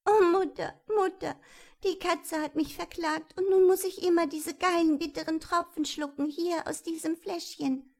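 cling to mother in fear, voice up high; frightened child. Acted. Röschen wakes and tells her mother of the bitter drops, high and frightened.

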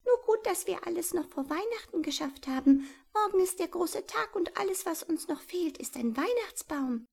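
high, fast little mouse voice; squeaky mouse mother. A narrator's reading. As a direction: chatter like a busy mouse mother. Mama Maus voiced high.

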